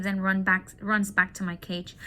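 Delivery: usual speaking voice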